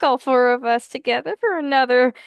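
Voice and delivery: ordinary delivery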